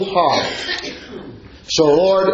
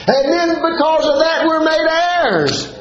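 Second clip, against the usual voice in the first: high, ringing preacher's cry; fervent preaching; 10 semitones higher than usual. Real, not acted. Preacher to congregation, ringing high and fervent. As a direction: lift the congregation with joy. Preacher rising at full force.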